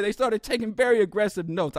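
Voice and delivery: usual speaking voice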